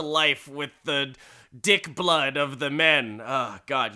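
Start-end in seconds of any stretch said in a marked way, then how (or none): none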